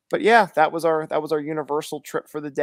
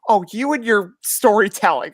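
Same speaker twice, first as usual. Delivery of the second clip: higher and louder teasing jab; imitating wife's teasing tone; mock teasing wife; 5 semitones higher, 8 dB louder than usual. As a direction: needle him playfully, voice up. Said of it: "she's like".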